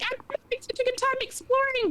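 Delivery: high, put-on little-kid delight; gleeful child self. Child lost in her own exploring, high and gleeful. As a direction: mimic a happy kid in a high voice.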